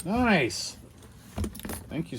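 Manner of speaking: normal talking voice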